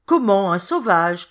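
loud exclaimed question; startled cry. A narrator's reading. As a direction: burst out loudly in disbelief. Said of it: "cria l'autre".

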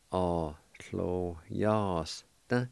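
"A clo yas da" at half speed, stretched out; slow model. Shown as a demonstration. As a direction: repeat "A clo yas da" very slowly. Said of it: "is pronounced like this".

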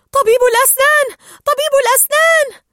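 very high, loud warning cries; alarmed shout. A narrator's reading. Tank fish warn of the dentist, high loud alarmed shouting. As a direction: yell a warning, twice over. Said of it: "فصاح سكان الحوض".

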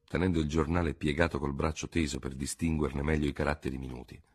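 ordinary delivery

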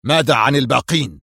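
mildly irritable question; a touch of nervy impatience. A narrator's reading. Boss asks about the other men, mildly irritable. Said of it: "سألها في عصبية".

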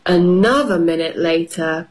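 ordinary delivery

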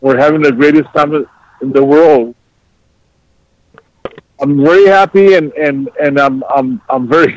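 normal talking voice

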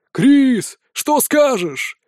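high, drawn-out call across a hall; crowd call-out. A narrator's reading. Drinkers at the tables prompting Crис for an answer, calling out. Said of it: "стали выкрикивать со столов".

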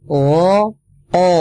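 o voiced from the palate; demonstrating the o sound; qaf sound demo. Shown as a demonstration. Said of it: "Nah, itu patokannya".

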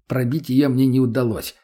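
usual speaking voice

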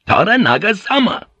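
high, loud bellow; commanding shout. Acted. Pilot facing down hesitating samurai with drawn swords, commanding shout. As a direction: roar the name to make them back down.

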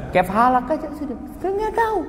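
high teasing voice mocking a Doha worker's Arabic. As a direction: lampoon someone who knows only Kef halak. Preacher mocks limited Arabic.

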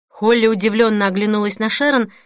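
ordinary delivery

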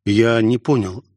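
ordinary delivery, nothing marked

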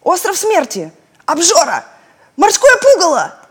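high loud shouted names; shouting guesses. Acted. Friends shout insulting name guesses at the island, loud and high.

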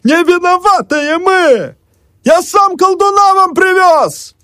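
very high, slow, loud bellow; desperate yell. A narrator's reading. Emelyan yelling to the mob from the window, desperate bellow. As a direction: bellow it out to the crowd. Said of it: "заорал".

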